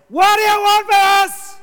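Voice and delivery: very high, loud, wild scream of a voice; demon's shout. Acted. Preacher screaming the demon's words at the congregation. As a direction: scream it out like a man possessed. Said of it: "shouted at the top of his voice".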